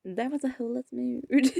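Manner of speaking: real laughter. Real, not acted. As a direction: say it, then crack up. Laughing fit continues.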